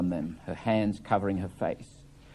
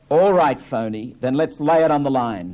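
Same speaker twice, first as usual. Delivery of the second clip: confronting; 4 semitones higher, 7 dB louder than usual. Acted. Biff confronts his father.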